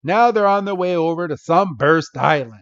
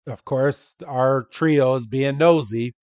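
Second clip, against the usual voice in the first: ordinary talk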